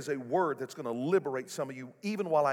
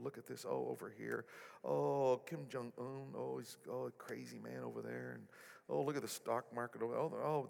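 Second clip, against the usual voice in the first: much softer, fretful hand-wringing muttering; mock panicking God; 3 semitones lower, 11 dB quieter than usual. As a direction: play a God fussing in worry over the world, much softer. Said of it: "God's not up there panicking".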